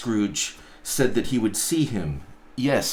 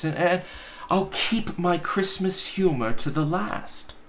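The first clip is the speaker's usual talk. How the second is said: put-on higher, earnest young nephew; nephew pleading; 8 semitones higher than usual. Nephew begs his uncle to explain, higher and earnest. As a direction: plead gently.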